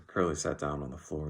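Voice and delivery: usual speaking voice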